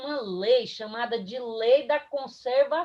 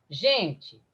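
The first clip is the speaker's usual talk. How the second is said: normal talking voice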